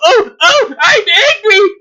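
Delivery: high, mock-tearful tantrum voice. Speaker in a bow tie mocked as throwing a tantrum, high and whiny. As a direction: play him as a little boy stamping his feet. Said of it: "this little temper tantrum here".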